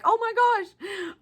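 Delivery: imitating her own giddy thrill; excited like a kid. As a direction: replay the giddy kid moment. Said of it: "I got so excited like a little kid".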